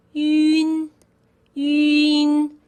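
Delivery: yun held long and level, yu closing on n; drawn-out yun. Shown as a demonstration. Teacher leading learners to say it, slow sustained yun. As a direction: stretch the yu, then add n for yun. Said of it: "add the n at the end".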